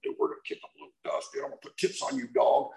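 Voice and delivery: whispered, fast tough-guy threat; prison talk. Retold or imitated. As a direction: hiss it like a con in the yard. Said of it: "it's like prison talk".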